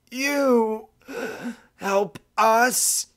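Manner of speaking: put-on high, thin voice of a dying old deer; wounded old pronghorn, breathless and high. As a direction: struggle out the question between breaths. Old pronghorn gasps a question.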